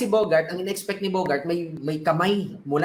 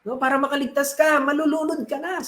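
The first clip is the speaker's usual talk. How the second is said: shouting from helicopter; 6 semitones higher than usual. Acted. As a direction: yell over the rotor noise to get him aboard. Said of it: "sinisigawan siya".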